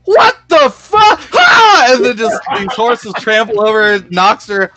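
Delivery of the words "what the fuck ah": much higher, louder shriek of shock; shocked scream. Acted. Bandit leader trampled by charging horses, shocked scream. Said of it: "the bandit leader ... is like".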